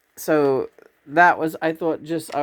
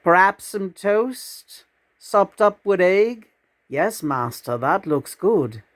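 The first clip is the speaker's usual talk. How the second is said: lilting verse, mostly higher in pitch; dog character in a poem; 4 semitones higher than usual. Acted. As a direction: recite as an eager dog asking for food.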